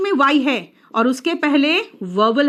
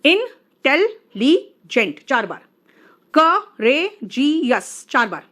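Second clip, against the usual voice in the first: slow, syllables stretched and counted; counting syllables; much slower than usual. Shown as a demonstration. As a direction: drag each word out so syllables can be counted. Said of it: "तीन बार". (शब्द धीरे खींचकर, शब्द खंड गिनते हुए)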